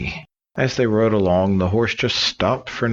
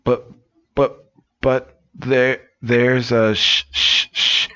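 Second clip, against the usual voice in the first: slow, higher stammer trying to warn; panicked stutter cut off by shushing; stuttering alarm; 6 semitones higher, much slower than usual. Acted. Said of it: "the fishermen go".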